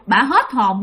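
plain reading voice

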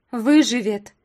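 loud firm retort; loud insistence. Acted. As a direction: snap back insistently. Young woman contradicts loudly.

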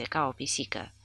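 usual speaking voice

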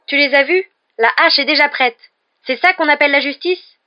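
loud, high-pitched outrage; angry protest. A narrator's reading. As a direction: cry out against an injustice. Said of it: "protesta Ron avec colère".

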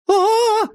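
очень высокий громкий визг; заверещи от удара током. Acted. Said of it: "заверещала она". (пронзительный визг от тока)

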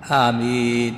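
"ameen" with a slow, stretched second vowel; drawn-out yaa in "ameen". Shown as a demonstration. Teacher checking the student means the long yaa, stretched "ameen". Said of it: "يعني قصدك مد الياء".

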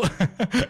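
a quick, high giggle. Real, not acted. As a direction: giggle at the tease. Laughs at own tease.

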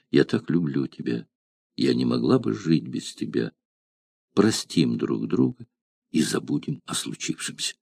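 soft, fading to a whisper. Acted. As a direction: murmur it close to his ear. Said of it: "а она шептала". (тихо, переходя в шёпот)

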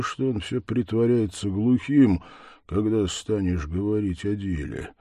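plain reading voice

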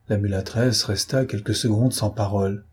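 usual speaking voice

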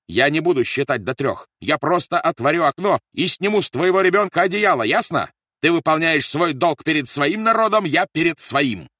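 high, loud, forceful tirade; shouted threat. A narrator's reading. Officer threatens to strip the baby by the window, shouted threat. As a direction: shout an ultimatum. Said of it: "продолжал кричать Рольф".

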